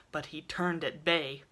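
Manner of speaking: ordinary delivery, nothing marked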